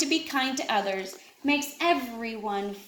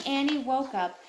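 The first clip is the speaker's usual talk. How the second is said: usual speaking voice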